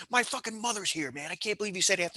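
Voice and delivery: high, furious complaint; imitating the livid birthday guy; furious friend. Friend scolds Straka for roasting in front of mom, mock furious. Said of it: "he was fucking furious".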